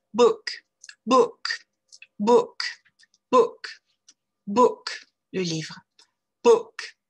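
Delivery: Book short and loose, said with a slight smile; English short oo. Shown as a demonstration. Teacher drilling Book for French learners with a short oo. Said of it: "vous forcer à sourire".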